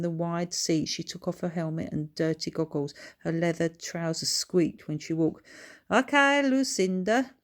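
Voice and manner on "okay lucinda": loud, higher Scottish greeting; hearty Scottish hello; Scottish accent. A narrator's reading. Bertha greets her sister in a strong Scottish accent. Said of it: "in a strong Scottish accent".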